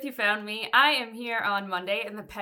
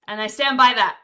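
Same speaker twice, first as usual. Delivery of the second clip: ordinary talk, nothing marked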